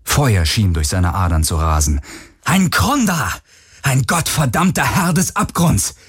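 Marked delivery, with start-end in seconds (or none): 2.4-5.9 high, furious commanding voice building to a shout. Acted. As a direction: command it in rage. Commanding the helix in rage.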